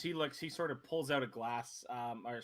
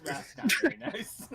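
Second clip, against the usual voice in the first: laughing; 8 semitones higher, 4 dB louder than usual. Retold or imitated. GM repeats line laughing.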